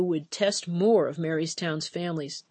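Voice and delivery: plain reading voice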